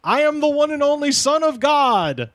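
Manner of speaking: high-pitched ranting mock proclamation; mock yell at normal volume; imitated street screaming. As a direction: declaim it high like a drunken prophet. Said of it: "screaming from the streets, quote".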